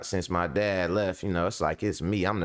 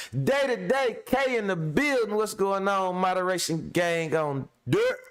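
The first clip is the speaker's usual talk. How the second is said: hype shout-out; 10 semitones higher than usual. Real, not acted. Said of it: "shout-out sign-on".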